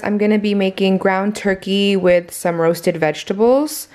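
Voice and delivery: ordinary delivery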